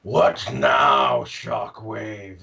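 lightly voiced tired villain; weary Megatron. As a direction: sigh it out, sick of everything. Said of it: "he's like".

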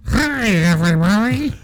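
creature talking underwater. Pretend. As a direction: gurgle it like you're submerged. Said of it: "That's what he sounds like underwater".

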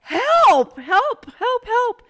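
very high, loud repeated calls; high-pitched mock panic shouting; retold cries for help. Retold shouting at brother's door.

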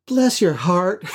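lightly imitated Southern accent, laughing through it; a fond Southern "Bless your heart" with a laugh; Southern drawl. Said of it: "it's such a southern thing".

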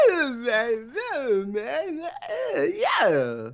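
high, wailing put-on voice; exaggerated high-pitched celebrity impression; John Travolta. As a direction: squeal it out in a silly falsetto. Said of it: "the, uh, John Travolta going on".

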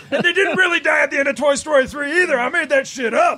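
high, loud, gleeful jeer; mock taunting shout. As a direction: yell it like a prankster rubbing it in. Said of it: "And I'd be like".

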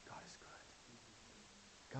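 hushed whisper; whispered "God is good" after the shout. Acted. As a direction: breathe it out slowly, barely voiced. Whispered after loud preaching.

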